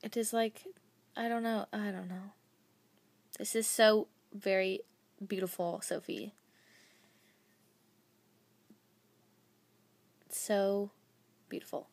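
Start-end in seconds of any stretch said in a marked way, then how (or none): 1.2-11.9 choked up. Real, not acted. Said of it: "I'll cry of beautifulness".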